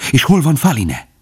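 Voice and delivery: a touch of breathless urgency; quick and excited. A narrator's reading. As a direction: rush the question, agitated. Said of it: "Bambi gyorsan, izgatottan és türelmetlenül beszélt".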